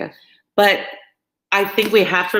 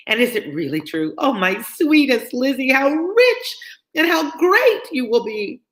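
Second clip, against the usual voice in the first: gushing Mrs Bennet; 8 semitones higher than usual. Acted. Mrs Bennet gushing to Lizzie over her engagement. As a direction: swoon over her daughter's riches. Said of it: "such an effusion".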